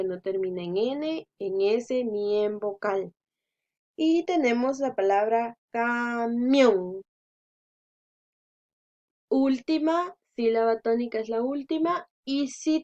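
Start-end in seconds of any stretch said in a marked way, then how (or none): 5.7-7.0 camión drawn out, "ca-MIÓN"; teacher sounding out camión slowly; final-syllable stress. Shown as a demonstration. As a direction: stretch camión, leaning on "mión".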